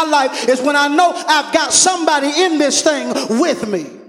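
loud, rising preaching call; full-force preaching. Acted. Pastor rousing the congregation, loud preaching. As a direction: stir up the church and call for a response.

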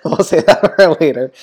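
talking through laughter; giggling through the words; laughing. Real, not acted. Laughs while repeating.

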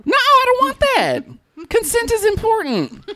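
very high, laughing objection; high-pitched comic outrage; squealed protest. Real, not acted. Mock protest at the bell stunt.

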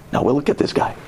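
ordinary delivery

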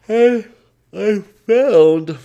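words stretched open by a real yawn; yawning while talking. Real, not acted. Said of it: "I'm just yawning a whole bunch".

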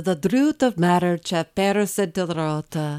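Chaucer read in Middle English sounds; slow, careful Middle English recital. Shown as a demonstration. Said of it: "That's, that's not so bad".

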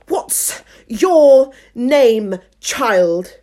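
loud, very slow imperious demand; severe queen. Acted. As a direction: interrogate a child word by word. Severe Queen questions Alice.

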